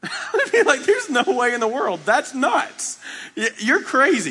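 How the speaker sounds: high-pitched, incredulous acted reaction; laughing disbelief. Pastor answering the stranger's belly flop request, incredulous. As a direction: burst in with a laugh, voice jumping right up.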